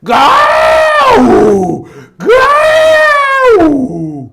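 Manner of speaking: very high drawn-out loud crow shout; crow call on an old tree. Retold or imitated. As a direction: cry out like a crow on a dead tree. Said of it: "고목나무에 앉아가지고 우는 소리를". (고목나무 위 까마귀의 길게 외치는 울음)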